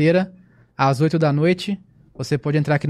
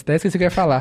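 normal talking voice

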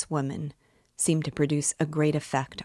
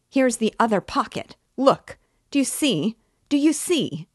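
rising higher and louder to a shrill climax; frantic Katerina; 5 semitones higher, 5 dB louder than usual. Acted. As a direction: build to a desperate triumph. Katerina shows empty pockets.

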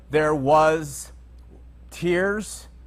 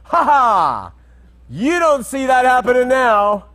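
mocking futurists; 6 semitones higher, 6 dB louder than usual. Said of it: "the futurists jump on and say".

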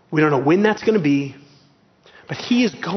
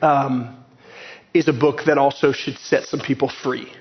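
ordinary talk

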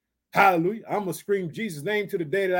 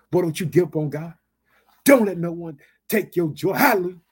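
ordinary delivery

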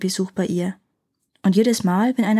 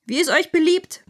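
elephant bellowing; 8 semitones higher than usual. Acted. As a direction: bellow it out carelessly. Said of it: "brüllte der Elefant".

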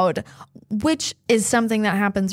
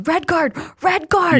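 high-pitched imitated yelling, punched out; mock yell; 8 semitones higher, 3 dB louder than usual. Dean yelling at the Quidditch match, mock yell. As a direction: call it out like a yelling fan. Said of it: "starts yelling".

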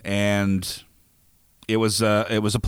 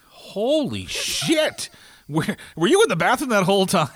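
shocked disbelief; 7 semitones higher than usual. Retold or imitated. To the guy back from the bathroom, shocked high disbelief. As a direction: drag the first words out high, then fire the question. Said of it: "And I was like".

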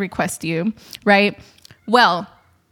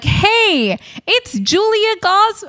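much higher, slow and sing-song; imitating the texter; 11 semitones higher, 3 dB louder, much slower than usual. Retelling the surprise text from the photographer, light imitation. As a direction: lightly voice a friendly hello. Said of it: "This person's like".

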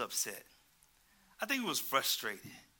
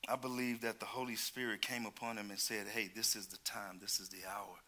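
much softer and lower; solemn hush; 6 semitones lower, 7 dB quieter than usual. Real, not acted. Preacher telling the church the hour has come, hushed. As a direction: lower the voice for a sacred moment.

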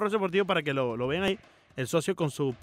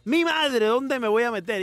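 mock panic; 4 semitones higher, 4 dB louder, slower than usual. Imitates cohost's panic.

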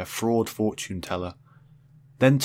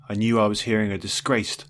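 ordinary delivery, nothing marked

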